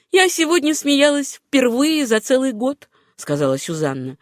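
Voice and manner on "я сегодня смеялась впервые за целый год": put-on higher, earnest young woman's voice; lightly voiced Susanna. A narrator's reading. Susanna confiding in John, in a lightly raised woman's voice. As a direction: lightly lift the voice for her confession.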